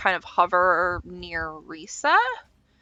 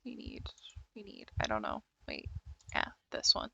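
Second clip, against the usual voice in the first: murmured under the breath; whispering; 13 dB quieter, faster than usual. Real, not acted. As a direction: whisper while searching. Checking dice quietly.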